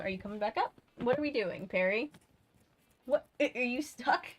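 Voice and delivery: ordinary delivery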